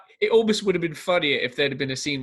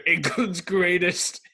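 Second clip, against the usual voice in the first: slow, higher, grandiose boast; mock movie hero; 5 semitones higher, 4 dB louder, slower than usual. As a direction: stretch it out as a hammed-up proclamation. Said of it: "He's like".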